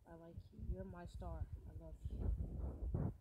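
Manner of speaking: whispered slow confession; soft confession. Pretend. Boy murmuring the game line to his crush, hushed. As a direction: breathe the words out shyly.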